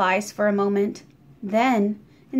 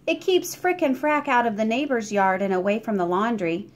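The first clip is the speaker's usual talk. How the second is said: usual speaking voice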